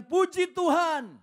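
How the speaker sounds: high, loud, slow praise; joyful praise. Retold or imitated. Believer praising God each morning, high and loud. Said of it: "Dia bilang".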